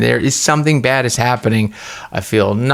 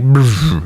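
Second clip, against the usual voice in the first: buzzing lip-trill noise; short "brrr" of draining life force; imitated sensation sound; 2 semitones higher than usual. Patient showing nurse the sensation, imitated buzzing noise. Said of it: "like this".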